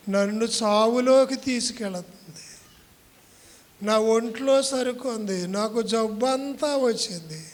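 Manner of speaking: loud, pleading prayer to God; fervent prayer. Real, not acted. Pastor leading the church in prayer, loud pleading prayer. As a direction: cry out the prayer to the Lord.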